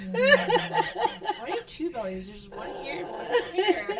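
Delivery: put-on high, slow child voice through laughter; laughing, squeaky mock kid voice; imitated teasing son. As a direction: mimic a small boy poking fun. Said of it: "Thomas comes up to me".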